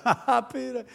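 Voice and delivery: jeering ha-ha from the shore crowd; mocking fishermen. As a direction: taunt him from the beach. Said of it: "guys on the shore are laughing at him".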